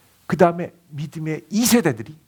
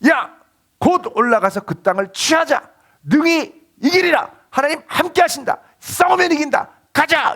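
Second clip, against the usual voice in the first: rallying call; 11 semitones higher, 4 dB louder than usual. Retold or imitated. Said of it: "딱 나타나서 뭐라 그러냐면".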